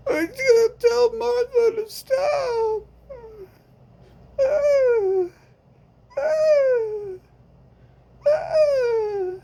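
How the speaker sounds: hammed-up sobbing through the pain; mock sobbing wail. Victim begging friend to stop the woman, fake sobbing.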